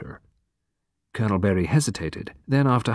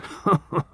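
chuckle; 7 semitones higher, 3 dB quieter than usual. A narrator's reading. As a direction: chuckle under the breath. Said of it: "Colonel Berry chuckled".

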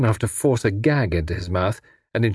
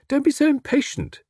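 very high-pitched, light rebuke; airy protest. Acted. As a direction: chide him lightly, voice leaping up. Tommy soothes captor flippantly.

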